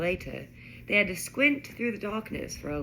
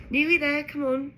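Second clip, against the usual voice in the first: high, louder coaxing; cajoling; 8 semitones higher, 6 dB louder than usual. A narrator's reading. Ron coaxes the shuddering car along, cajoling. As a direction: urge it on encouragingly. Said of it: "Ron said cajolingly".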